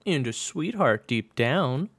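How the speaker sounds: put-on high, slow, teasing baby talk. A narrator's reading. June teases friend pinching his cheek, baby talk voice. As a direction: coo it loudly and slowly, sarcastically sweet. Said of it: "in the baby talk voice that irritated him".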